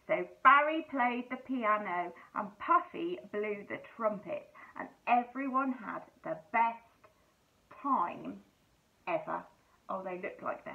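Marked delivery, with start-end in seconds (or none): none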